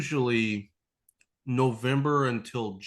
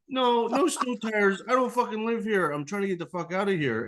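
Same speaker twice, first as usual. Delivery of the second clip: exasperated refusal; 10 semitones higher than usual. Retold or imitated.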